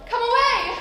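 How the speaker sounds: calling out. Acted. Wind coaxes girl to follow.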